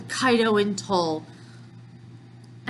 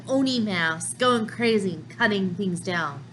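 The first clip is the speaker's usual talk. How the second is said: ordinary delivery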